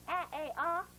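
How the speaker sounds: "aah" as in "cat", then "ay", then "ah"; three separate vowels in a row; three sounds of A. Shown as a demonstration. Teacher dictating phonogram to pupils, three A sounds. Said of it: "If I say".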